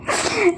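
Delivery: short high giggle mid-sentence; can't help laughing at it; real laugh. Real, not acted. Laughing again at the memory. (हल्की सी हँसी)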